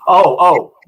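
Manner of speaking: loud, excited oh oh; sudden realization. Real, not acted. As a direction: it just hit you, blurt it out. Suddenly remembering a grail.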